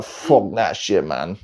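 slow, mock-disgusted dismissal, dropping off; imitated disgust, trailing down low; retold disgust. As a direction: drag it out like an appalled mate.

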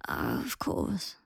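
put-on low, slow, faint old voice; dazed invalid father, slow and soft; frail old man. A narrator's reading. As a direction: drag it out like a sick old man. Frail old Lucius answers.

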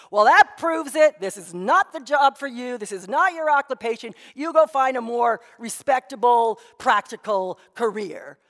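a disapproving father laying down the law; stern father. Retold or imitated. Father scolding daughter.

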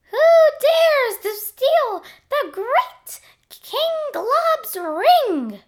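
high, slow whispery squeak; a mysterious squeaky monster; squeaky creature voice. Acted. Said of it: "came a mysterious yet squeaky voice".

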